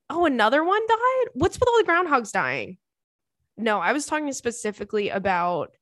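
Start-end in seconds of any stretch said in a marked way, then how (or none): 0.1-2.8 high, louder shocked question; surprised. Real, not acted. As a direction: caught off guard, voice jumps up. Learns another groundhog died.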